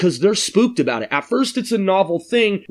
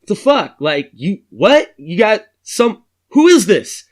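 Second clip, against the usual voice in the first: higher and louder, startled outrage; shocked stranger; 4 semitones higher, 5 dB louder, slower than usual. Retold or imitated. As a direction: bark it at the phone in disbelief.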